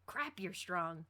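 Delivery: plain reading voice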